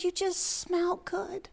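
slow, hushed whisper; retold tearful whisper. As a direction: imitate her barely whispered words. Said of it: "she's in tears".

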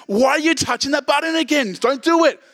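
very high, exasperated comic scolding; God. Acted. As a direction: tell off a meddler as a hammed-up high-voiced Almighty. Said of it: "God comes and says".

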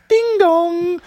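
high sing-song doorbell call; high chirpy caterpillar announcing himself. Acted. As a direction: ring the bell as a tiny cartoon caterpillar. Caterpillar voiced at the door.

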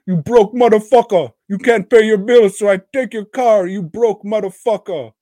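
high, loud, hammed-up rant; mock angry yell. Host as repo man taking a car, mock angry yell.

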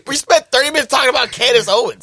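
loud, high exasperated protest. Real, not acted. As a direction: throw up your hands in disbelief.

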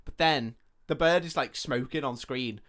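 usual speaking voice

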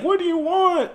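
high, frightened cry of the victim. Retold or imitated. Recounting Casey on the phone, a high frightened cry. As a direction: yelp it like a scared girl on the phone. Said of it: "she's like".

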